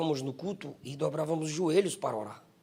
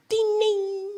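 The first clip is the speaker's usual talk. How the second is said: bell ringing. Retold or imitated. Bell compared to trumpet.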